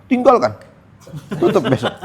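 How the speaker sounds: blunt mock verdict. Mock ustadz's blunt answer.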